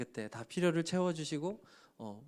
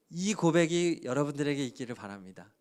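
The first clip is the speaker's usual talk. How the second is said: usual speaking voice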